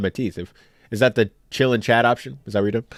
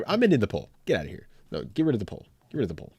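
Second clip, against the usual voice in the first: ordinary talk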